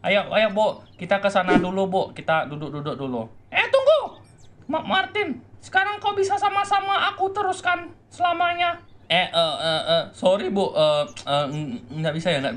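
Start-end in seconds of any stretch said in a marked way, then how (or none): 3.5-8.8 much higher, put-on motherly voice; a mother overjoyed to find her son; mother character voice. Mother reunited with her son Martin, much higher put-on voice.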